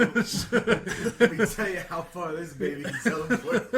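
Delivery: loud, slow, laughing brag; cracking up while bragging. Real, not acted. Laughing brag about teleport.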